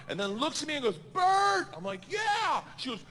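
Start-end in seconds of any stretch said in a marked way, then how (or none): 1.1-1.7 high, loud imitated call. Comic imitates her calling him at the plane door, loud call. She calls him over engine noise. 2.1-2.6 high-pitched mock panicked answer; shouted reply. Comic retells answering her at the plane door, high shout.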